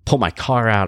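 usual speaking voice

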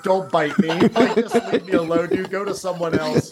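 half-laughing mock plea, don't bite me; giggling through a silly plea to the bug; laughing plea to a flea. Recruit laughingly begging a sand flea to leave. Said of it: "that internal dialogue or trying to, like, talk to it".

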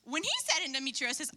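ordinary delivery

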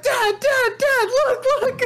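high excited calling out. Retold or imitated. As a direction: call your dad, thrilled. Retelling pointing at screen excitedly.

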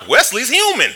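loud, high, amazed declaration; a sudden discovery thrown out loud; excited exclamation. Retold or imitated. Realizes the bully can be beaten.

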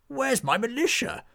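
suddenly notice everyone has vanished; surprised knight. Acted. Knight discovering his militia fled, high startled cry.

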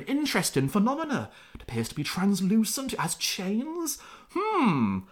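high, lightly hammed-up scholar taking notes; pompous philosopher. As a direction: peer at the ghost like a fussy professor. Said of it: "starts making notes, going".

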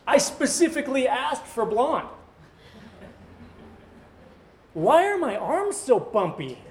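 put-on high, fussy baby griping at parents; complaining newborn. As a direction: voice a newborn outraged at everything. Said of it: "imagine a baby being born and going like".

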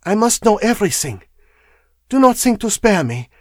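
put-on high, firm foreign-accented woman; insistent foreign wife. A narrator's reading. Foreign wife demands the truth.

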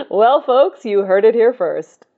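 hammed-up anchor breaking a story; news anchor. Playing a news anchor interviewing the cat. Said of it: "This just in".

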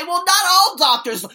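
high, loud mocking whine; mock objector. Mocking people who defend American doctors, loud high whine. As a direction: parody the naysayers with a high whiny voice. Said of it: "Some people will say".

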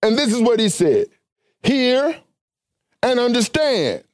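high-pitched, emphatic Bible exposition; preaching. Real, not acted. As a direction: preach it in a high, ringing register, phrase by phrase.

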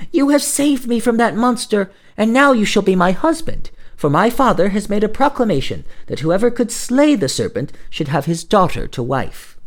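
high, joyful maiden voice; grateful and eager; princess. A narrator's reading. Rescued princess tells the hero he will marry her, joyful.

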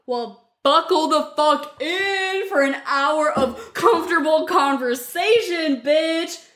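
slow, drawn-out announcer hype; hype intro shout. Acted. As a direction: sell the show like a hyped host.

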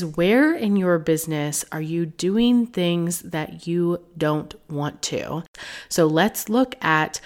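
normal talking voice

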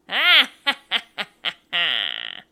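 loud theatrical evil cackle; villainous laugh. Acted. Narrator closing on a Thriller night, cackling. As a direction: cackle in long, booming bursts.

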